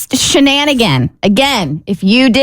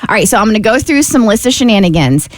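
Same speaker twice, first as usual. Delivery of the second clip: ordinary delivery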